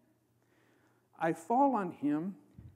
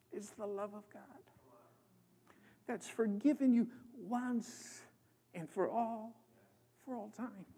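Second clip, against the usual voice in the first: choked, much softer through tears; crying; 3 semitones higher, 9 dB quieter than usual. Real, not acted. Preacher moved to tears telling the congregation of forgiveness, choked and soft. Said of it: "now I'm crying good".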